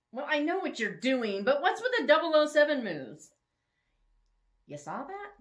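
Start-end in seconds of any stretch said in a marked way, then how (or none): 0.1-3.3 loud, high, amused teasing; Bethany teasing him, loud and high. Acted. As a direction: call out his spy moves with glee. Bethany teasing the sneaking hero.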